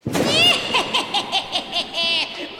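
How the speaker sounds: loud high cackling witch laugh; witch cackle. Pretend. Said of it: "can we get a witch laugh for the podcast".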